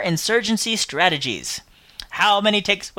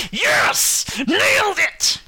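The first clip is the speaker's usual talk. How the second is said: high, delighted cheer; triumphant; 12 semitones higher, much slower than usual. Real, not acted. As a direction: celebrate getting it right. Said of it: "finally read it right".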